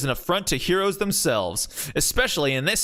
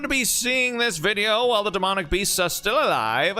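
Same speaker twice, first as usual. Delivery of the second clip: higher, grand radio announcer voice; booming old newsreel announcer; old-timey radio announcer; 4 semitones higher than usual. Acted. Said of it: "give him the old-timey radio announcer voice".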